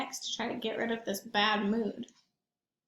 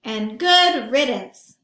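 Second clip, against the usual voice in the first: relieved good riddance; 10 dB louder, much slower than usual. Acted. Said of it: "thought Big Rabbit".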